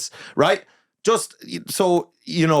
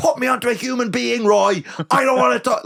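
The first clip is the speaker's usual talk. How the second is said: losing it; 6 semitones higher than usual. Retold or imitated. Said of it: "I is fucking losing the rag".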